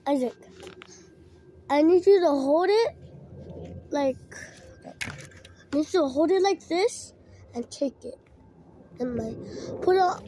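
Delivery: ordinary talk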